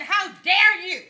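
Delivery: loud, slow, offended "how dare you"; indignant church lady. Retold or imitated. Church lady scolding her pastor, indignant. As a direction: huff it out like an insulted church lady.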